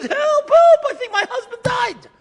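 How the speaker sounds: very high, loud scream for help. Retold or imitated. Widow screaming from the front window to the street, high loud scream. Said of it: "she yells and screams".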